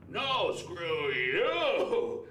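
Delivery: very slow, slurred holler back; hammed-up yell, every word stretched; mock drunken street shout, dragged out; second arguing bum. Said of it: "the other guy's like".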